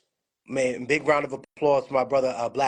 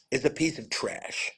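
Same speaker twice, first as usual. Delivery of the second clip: usual speaking voice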